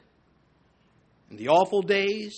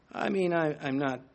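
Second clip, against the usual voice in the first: ordinary talk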